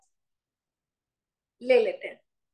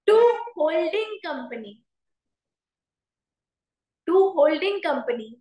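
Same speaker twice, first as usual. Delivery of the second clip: drawn-out words for note-taking; slow dictation; much slower than usual. Real, not acted. Teacher reading out notes for students to write, slow dictation. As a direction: dictate it very slowly, word by word.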